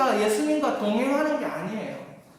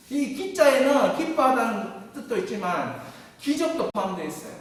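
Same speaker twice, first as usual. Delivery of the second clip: normal talking voice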